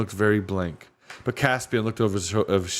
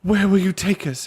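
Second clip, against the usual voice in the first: Lucy forcing out the words, much higher; scared girl struggling to speak; halting words; 11 semitones higher, 3 dB louder than usual. A narrator's reading. Lucy asking the slaver, frightened and halting. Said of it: "getting the words out with some difficulty".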